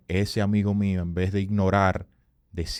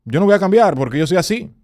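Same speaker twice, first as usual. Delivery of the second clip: higher and louder, stubbornly insisting; mocking a fixed-mindset excuse; 7 semitones higher, 8 dB louder than usual. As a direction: play the person who refuses to change. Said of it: "se dice".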